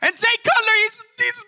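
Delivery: high-pitched ranting sports fan; top-of-lungs screaming. Acted. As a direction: scream it in comic outrage. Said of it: "screaming at them at the top of the lungs".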